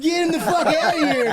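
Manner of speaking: laughing, high jeering crowd voice; mock crowd jeer. Retelling his plane exit, laughing mock jeer. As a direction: imitate the crowd through laughter.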